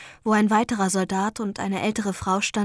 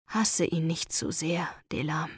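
soft, lower tender voice; 5 semitones lower, 7 dB quieter than usual. Acted. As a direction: comfort her gently. Gentle comfort after rescue.

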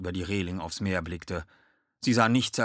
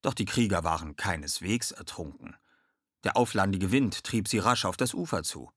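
plain reading voice

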